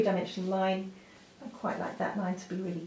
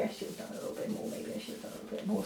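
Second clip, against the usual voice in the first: fast whispered mutter to herself; quick whispered self-reproach over the drawing; whispered aside; 10 dB quieter, much faster than usual. Real, not acted. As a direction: whisper it quickly, half to yourself. Muttered self-correction while drawing.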